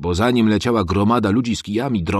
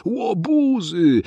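high, loud, drawn-out shout; mother shouting at the crowd; calling out; 12 semitones higher, 4 dB louder, much slower than usual. A narrator's reading. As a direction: stretch it out to scold the mob. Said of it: "zawołała nasza mama".

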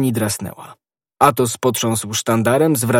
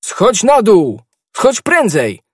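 loud, high urgent yell; high-pitched shouted command; shouting; 10 semitones higher, 7 dB louder, slower than usual. A narrator's reading. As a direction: call out urgently like a whole camp yelling. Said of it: "Cały obóz wrzeszczał".

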